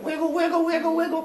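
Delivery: high, sing-song wiggle; playful wiggle. Acted. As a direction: bounce it like a silly chant. Hephaestus wiggling axe.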